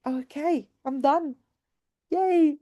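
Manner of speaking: ordinary talk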